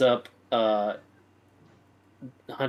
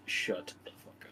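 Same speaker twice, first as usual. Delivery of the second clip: a breathless whisper, no voice at all; whispered insult; 3 semitones higher, 7 dB quieter than usual. Acted. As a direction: spit it out under the breath so only he hears. Character hissing an insult.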